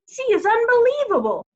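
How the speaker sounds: high, loud delight; gushing. A narrator's reading. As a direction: marvel at her. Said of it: "wife gushing over the pig".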